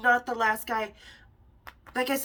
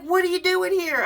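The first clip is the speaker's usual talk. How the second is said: excited surprise; 8 semitones higher, 6 dB louder than usual. Retold or imitated. Vinny thrilled to see her.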